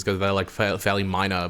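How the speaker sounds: usual speaking voice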